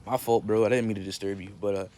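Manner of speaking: normal talking voice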